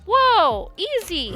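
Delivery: startled calming. Pretend. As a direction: flinch and soothe at once.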